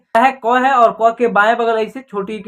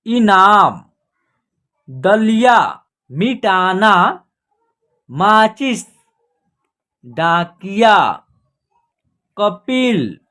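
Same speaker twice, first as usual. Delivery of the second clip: drawn-out, emphatic words with long pauses; word-by-word reading; 3 dB louder, much slower than usual. Shown as a demonstration. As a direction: model each word for a beginning reader, stretching its vowels. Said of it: "तीन अक्षर वाले शब्दों को पढ़ना सिखाएंगे". (एक-एक शब्द ज़ोर से, खींचकर पढ़ना)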